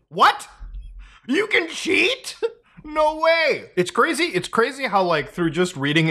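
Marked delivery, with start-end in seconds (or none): 0.1-3.7 high, hammed-up disbelief; mock shock. YouTuber reacts to a community note, mock shock. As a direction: feign amazement, stretching it out in a high voice.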